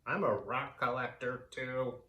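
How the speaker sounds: otherworldly alien voice, a touch of a sigh. Acted. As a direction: admit it wistfully, alien and mild. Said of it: "he sighed".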